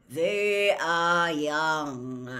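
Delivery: very slow, loud mournful drone; slow, doleful. A narrator's reading. Centaur behind Harry speaks up for the captives, slow and doleful. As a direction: draw each word out dolefully. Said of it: "said a slow, doleful voice".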